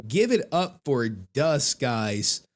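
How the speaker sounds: ordinary delivery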